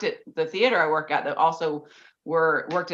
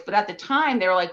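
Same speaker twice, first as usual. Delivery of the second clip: ordinary talk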